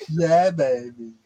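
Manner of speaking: a drawn-out mock Austin Powers line; Austin Powers impression. Joining in on the Austin Powers voice, mock Austin Powers. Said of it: "sounds exactly like Austin Powers".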